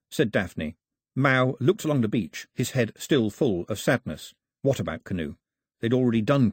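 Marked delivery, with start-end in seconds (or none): none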